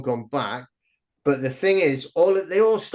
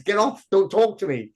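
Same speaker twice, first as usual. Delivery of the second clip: higher-pitched imitation of annoyed users; mock indignant dismissal; 6 semitones higher than usual. Americans rejecting Chinese users on social media, mock indignant. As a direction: act out brushing off unwanted strangers, voice raised in pitch.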